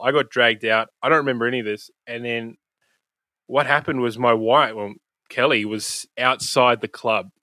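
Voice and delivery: normal talking voice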